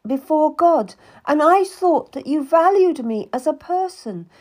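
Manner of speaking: high, loud wounded outburst; tearful anger. Acted. Cat accuses Anne of trying to buy her, tearful and angry. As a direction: pour out the betrayal.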